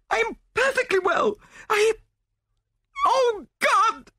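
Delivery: very high, loud, breaking into horror; hysterical protest. A narrator's reading. Widow denies she is unwell, then panics, hysterical. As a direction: protest shrilly, then cry out in terror.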